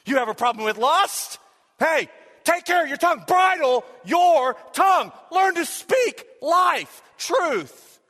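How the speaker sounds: high, shouted, punched-out exhortation; full-force preaching. Real, not acted. Preacher urging the church to speak life, shouting fervently. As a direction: hammer each phrase at the congregation.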